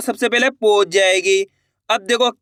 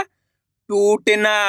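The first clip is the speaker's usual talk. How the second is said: very slow blending; much slower than usual. Shown as a demonstration. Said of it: "तो बताइए मिलके क्या बनेगा".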